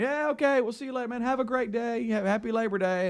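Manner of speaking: high, cheery send-off voices; chirpy see-you-later voices of the interns; voicing the departing interns. Speaker mimics the interns leaving for the holiday, high and cheery. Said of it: "I hear kind of everybody going".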